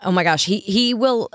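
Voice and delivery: ordinary talk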